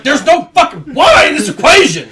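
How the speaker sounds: loud, high outraged yell; exasperated yell. Retold or imitated. Student yells at the calculus equation. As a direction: shout at the impossible problem.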